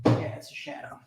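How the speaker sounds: soft whispered repeat. Acted. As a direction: whisper it under your breath. Repeats shadow answer, whispered.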